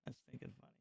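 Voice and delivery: a whispered aside to himself. Real, not acted. Streamer repeating the joke to himself, whispered. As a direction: breathe it out under your breath. Repeats line under breath.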